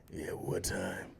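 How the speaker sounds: very low, soft gruff voice; gruff hero. Acted. Mock hero asking about the drop, low and gruff. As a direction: growl it quietly like a superhero. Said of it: "heroes with the gruff voice".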